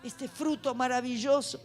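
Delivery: loud, impassioned sermon; fervent preaching. Acted. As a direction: preach with rising fire. Preaches at full force.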